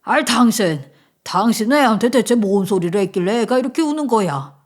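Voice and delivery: indignant husband scolding his wife; acted angry shout. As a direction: tell her off forcefully. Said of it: "저에게 소리를 질렀어요". (아내에게 버럭하는 남편)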